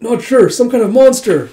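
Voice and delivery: high, loud panicky report; alarmed. Retold or imitated. Soldier facing some kind of monster, alarmed. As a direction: answer as if badly spooked.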